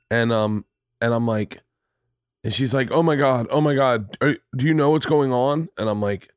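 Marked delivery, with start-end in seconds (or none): none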